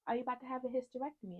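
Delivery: quick, high-pitched technician question; high and hurried, without pauses; repeated retold question. Technician's question repeated straight through.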